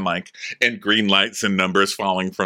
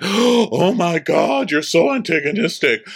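much higher, slow, put-on shocked clutching pearls; mock gasp; 9 semitones higher, 4 dB louder, slower than usual. Mocking Robin acting shocked at someone she provoked, high put-on gasp. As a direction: drag it out in a fake scandalised voice.

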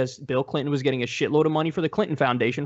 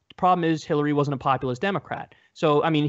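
normal talking voice

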